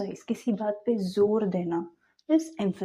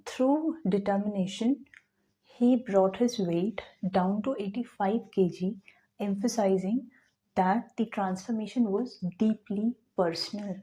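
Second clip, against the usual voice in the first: 1 slow read-aloud; much slower than usual. Shown as a demonstration. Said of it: "teacher reads the quoted passage".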